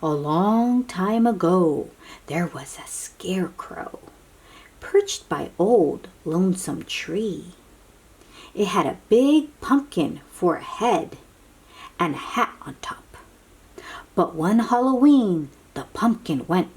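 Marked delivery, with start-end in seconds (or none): 2.3-4.0 hushed, whispering the spooky bit. A narrator's reading. Reading a spooky story to kids, whispered. 12.0-13.2 hushed, whispering the spooky bit. A narrator's reading. Reading a spooky story to kids, whispered.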